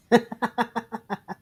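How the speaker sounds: short breathy laugh; quick amused laugh. Real, not acted. Laughing at request.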